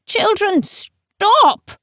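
high, urgent call to stop; high cry. A narrator's reading. Teacher tries to halt them from the hatch, high cry. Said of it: "cried a voice high from the hatch".

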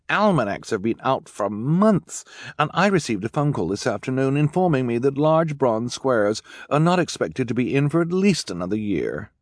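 high, dismissive fashion authority; Esme. Acted. Esme dismissing gifts that are out of fashion, haughty.

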